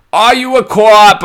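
put-on high, loud, drawn-out Boston accent. Podcaster imitating The Departed, loud Boston accent. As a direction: shout it in a mock Boston accent. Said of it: "whip out my Boston accent".

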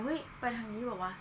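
soft mutter under the breath, as if alone on the train; muttering to himself. Retold or imitated. Man muttering alone while heading for the BTS. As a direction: mumble it to yourself behind a mask. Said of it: "กูก็พูดคนเดียว".

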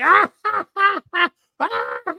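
shrill fake "ah-ah-ah" laugh; mock hysterical laughter. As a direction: imitate someone laughing wildly at you. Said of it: "she starts laughing, laughing, fucking hysterically".

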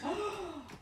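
sharp theatrical intake of breath; dramatic gasp. Pretend. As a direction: gasp in mock shock. Dramatic gasp after boys.

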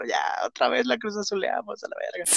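high, soft quoted voice; lightly voiced goalkeeper; quoting the goalkeeper. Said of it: "Corona ya había dicho".

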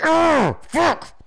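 high, roared burst of cursing; retold rage. Retelling each return of the scorpion pain, raging outburst. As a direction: re-enact a roar through a wave of pain. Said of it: "every time I just fucking rage".

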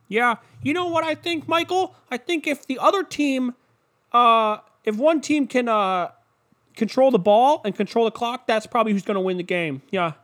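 high, hammed-up sports analyst voice; mock pundit earnestly offering an obvious take; pregame show pundit. Analyst answering the host on an endless pregame show, mock-earnest. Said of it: "could you just imagine just the takes".

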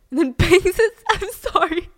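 can't hold back the giggle; laughing. Real, not acted. Host cracking up mid-story, in real laughter. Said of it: "It's the way I said it".

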